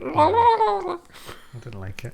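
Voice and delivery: high, slow, strange weasel voice; Weasel impression. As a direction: squeak it out like Weasel. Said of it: "That's my Weasel impression".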